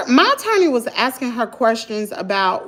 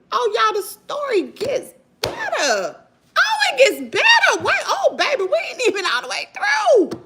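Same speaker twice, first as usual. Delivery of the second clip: higher, laughing, shouting it gets better; gleeful teasing; 14 semitones higher than usual. Real, not acted. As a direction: tease the audience with delight. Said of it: "hyping audience, laughing".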